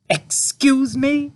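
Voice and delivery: very high, slow, loud shocked mother; horrified. A narrator's reading. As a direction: recoil at the stench.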